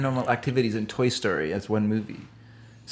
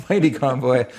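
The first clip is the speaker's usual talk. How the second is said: ordinary talk, nothing marked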